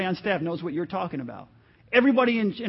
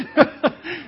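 laughter; 8 semitones higher, 6 dB louder than usual. Real, not acted. Laughing at son's remark.

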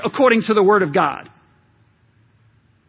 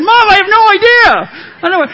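exasperated shout; 11 semitones higher, 9 dB louder than usual. Retold or imitated. Son shouting to his mother over people disliking him, exasperated. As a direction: shout it high and loud, fed up. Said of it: "I said".

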